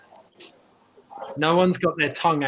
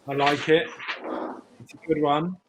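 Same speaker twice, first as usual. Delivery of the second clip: ordinary talk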